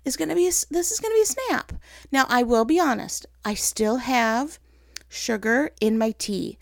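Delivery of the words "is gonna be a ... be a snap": overconfident. Retold or imitated. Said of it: "I was like".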